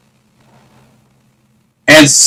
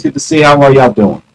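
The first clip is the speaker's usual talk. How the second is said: normal talking voice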